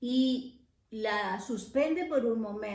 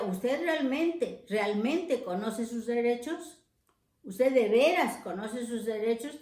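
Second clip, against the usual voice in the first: "realmente" and "de veras" lifted and stressed, "sus" left light; emphasis on realmente. Shown as a demonstration. Teacher showing where the emphasis belongs in Spanish. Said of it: "Tendríamos que darle el énfasis".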